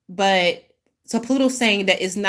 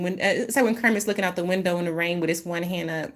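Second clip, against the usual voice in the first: ordinary talk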